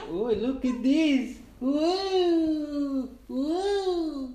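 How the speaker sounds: loud and slow, delighted; thrilled playful exclaiming; excited. Real, not acted. Excited play with baby.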